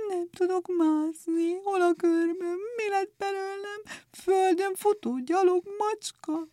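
whining high cat voice. A narrator's reading. Clawless cat lamenting in a high whine.

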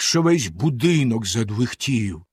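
ordinary delivery

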